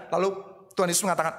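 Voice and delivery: ordinary delivery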